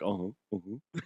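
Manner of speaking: quiet closed-mouth uh-huh; wordless acknowledgement. Retold or imitated. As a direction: imitate nodding along with hardly any voice. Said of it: "I didn't say a fucking word".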